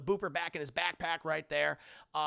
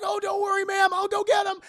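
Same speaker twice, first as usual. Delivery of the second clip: much higher, louder hero voice; eager heroic shout; 13 semitones higher, 11 dB louder than usual. Retold or imitated. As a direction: shout the promise, all confidence. Spider-Man eager heroic shout.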